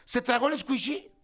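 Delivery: pregunta aguda de sorpresa; incredulidad alarmada. Retold or imitated. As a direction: reacciona con asombro, subiendo el tono. Reacción retratada a la noticia. (asombro alarmado, en tono agudo)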